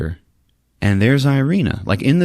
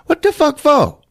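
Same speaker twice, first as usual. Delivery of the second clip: high mock cabbie squawk, a bit louder; cabbie retort; 4 dB louder than usual. Mock cabbie scoffing at passenger's zoo wish, high squawk. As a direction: play the rude cabbie scoffing.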